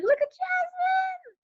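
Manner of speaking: high, slow delighted call; excited. Real, not acted. Birthday girl spotting her cousin on screen, excited high call. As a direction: squeal the name with joy.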